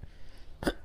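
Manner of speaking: quick rough throat clear. Real, not acted. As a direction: clear the throat before going on. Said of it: "excuse me".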